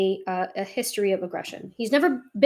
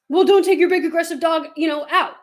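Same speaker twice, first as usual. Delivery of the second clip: higher, louder scornful mimicry; lightly hammed-up lecturing critic; mocking critic; 6 semitones higher, 5 dB louder than usual. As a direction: parrot a preachy critic with scorn. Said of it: "the second part of that".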